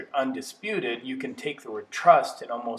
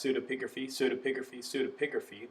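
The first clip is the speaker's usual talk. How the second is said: quick, softer tongue-twister run; three times fast; 6 dB quieter, faster than usual. Shown as a demonstration. Lecturer demonstrating a fancy word for students, said fast. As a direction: rattle off the hard word in rapid repeats. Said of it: "say it three times fast".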